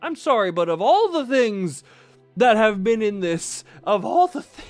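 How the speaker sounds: loud disbelieving outburst; baffled and laughing in disbelief; incredulous. Real, not acted. Shocked at diamond pickaxe.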